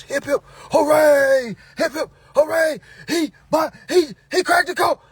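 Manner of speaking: hammed-up cheering of a hero; mock crowd cheer. Imagined crowd lifting him on shoulders, loud hammed-up cheer.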